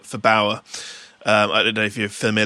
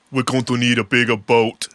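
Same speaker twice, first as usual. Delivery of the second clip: movie line in a put-on Austrian accent; Arnold Schwarzenegger. Shown as a demonstration. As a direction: quote it like an Austrian action star. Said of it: "in Arnold Schwarzenegger's accent and guess the movie".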